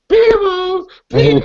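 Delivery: put-on high, loud squeaky child voice with a cry in it; mock little girl. Retelling the movie girl to friends, loud squeaky child voice. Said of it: "She's like".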